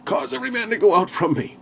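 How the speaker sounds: high, ringing command to clear the room; ringing royal command; commanding yell. Retold or imitated. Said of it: "He yells out".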